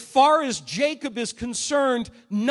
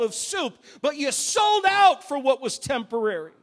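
impassioned preaching; 4 dB louder than usual. Acted. Preacher scolding Esau for selling his birthright, impassioned preaching. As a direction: rebuke building steadily in volume and pitch.

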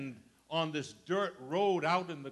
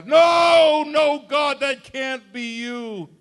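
loud, high protest slowing into a laugh; mock disbelief; 8 semitones higher, 12 dB louder, slower than usual. Doubting man refusing God's help, shouting with a laugh. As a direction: protest loudly then laugh it off. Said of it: "mock doubting man".

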